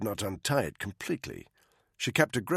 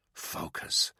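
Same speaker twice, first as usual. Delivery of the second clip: unvoiced self-command; a whispered reminder to stay sharp; whispered to himself; 4 dB quieter than usual. Soldier steadying himself, whispering one word. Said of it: "he told himself".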